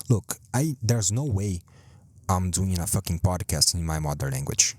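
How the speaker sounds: ordinary delivery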